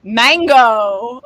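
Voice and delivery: loud, high, performed cry of MANGO; loud character call. Acted. Comedian recalling a favorite sketch character, loud showy call. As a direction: belt out the name, drawn out.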